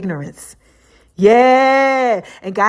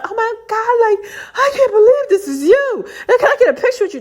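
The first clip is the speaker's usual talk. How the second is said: imitating a fan squealing high with excitement; high-pitched starstruck gush, close to tears; 11 semitones higher than usual. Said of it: "They were so happy".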